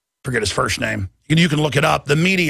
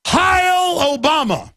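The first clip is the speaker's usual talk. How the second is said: slow, high mocking chant of Heil Obama; mock salute; 11 semitones higher, much slower than usual. Mocking the press who serve Obama. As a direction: ham up a sycophant's salute. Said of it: "And they say".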